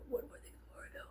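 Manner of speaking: a soft unvoiced whisper. Shown as a demonstration. Vlogger teasing viewers with a whispered announcement. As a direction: hush it like a secret. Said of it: "repeated line, whispered".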